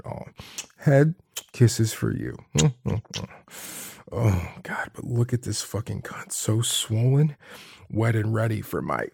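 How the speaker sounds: ordinary talk, nothing marked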